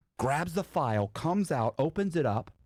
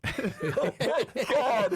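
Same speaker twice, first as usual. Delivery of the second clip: high, slow, laughing; storyteller cracking up at his own tale; laughing oh my God; much slower than usual. Real, not acted. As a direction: let it spill out laughing.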